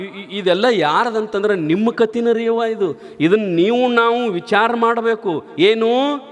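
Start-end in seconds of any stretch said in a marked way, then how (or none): none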